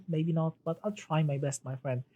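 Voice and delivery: normal talking voice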